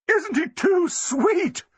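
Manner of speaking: high, slow, insistent gush; demanding gush. A narrator's reading. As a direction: draw it out, demanding agreement. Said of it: "she demanded".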